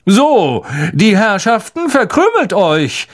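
high, loud dismissive call. Acted. Lockhart calls to the crowd, loud. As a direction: shoo the crowd away grandly. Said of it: "rief Lockhart den Umstehenden zu".

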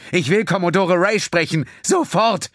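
president's angry shouted command; furious demand. A narrator's reading. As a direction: slam down the demand. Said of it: "verlangte sie mit wachsender Wut".

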